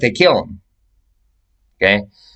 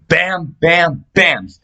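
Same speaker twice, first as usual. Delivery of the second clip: higher mock bam bam bam; imitated punches, higher; punch sounds; 6 semitones higher, much slower than usual. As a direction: act out the blows with voice. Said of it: "I said".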